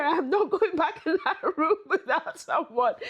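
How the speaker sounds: high, loud protest breaking into a laugh; retold panic dissolving into laughter; scared laugh. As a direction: laugh through the frightened refusal. Fleeing ghost, laughs mid-line.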